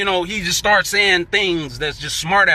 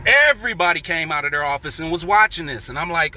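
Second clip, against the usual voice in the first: ordinary talk, nothing marked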